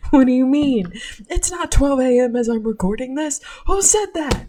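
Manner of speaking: loud, high mock outrage; mock offended. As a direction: act scandalised, playfully.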